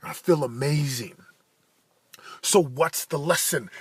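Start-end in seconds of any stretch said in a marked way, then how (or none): none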